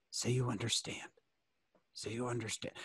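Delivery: imitated secretive sibling murmur; hushed urging. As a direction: plead under your breath as a worried sibling. Siblings urgently coaching under their breath.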